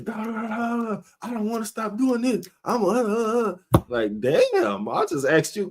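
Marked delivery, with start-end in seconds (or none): 0.0-3.8 mocking babble. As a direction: whine it back in a high, mocking sing-song. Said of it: "All you getting is".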